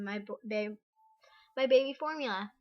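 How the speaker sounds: real stumble, restarting the word; stuttering. Real, not acted. Mother stumbles mid-explanation about formula, stuttering. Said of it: "Sorry, stuttering".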